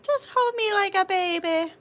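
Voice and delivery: high, slightly babyish mock plea; playful pleading. Asking people to carry her in water, playfully pleading. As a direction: plead in a high, drawn little voice. Acts out begging to be carried.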